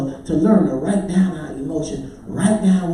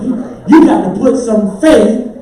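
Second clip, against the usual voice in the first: loud, rising preacher's exhortation; punched-out pulpit shout; full-force preaching; 5 semitones higher, 9 dB louder, slower than usual. Real, not acted. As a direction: drive it home to the congregation, slowing at the end.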